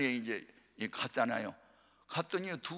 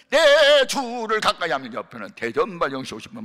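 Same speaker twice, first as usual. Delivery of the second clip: loud, raised voice over the noise; 5 semitones higher, 12 dB louder than usual. Retold or imitated. Preacher recalling hymns drowned by bar songs, loud. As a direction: belt it out like singing over the bar next door. Rival songs through thin wall.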